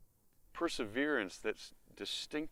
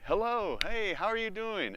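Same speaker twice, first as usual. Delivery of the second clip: bright, much higher and louder hello; sing-song hello to someone coming home; cheery greeting; 9 semitones higher, 6 dB louder than usual. Pretend. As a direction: greet them with big enthusiasm.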